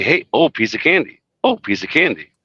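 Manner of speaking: high put-on cartoon dad voice; squeaky goofy cartoon voice; Peter Griffin voice. Shown as a demonstration. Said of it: "say it higher".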